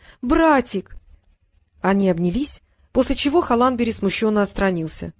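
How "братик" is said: higher-pitched, affectionate cry; exclaiming. A narrator's reading. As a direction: greet a little brother with mild excitement. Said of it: "воскликнула Тиана".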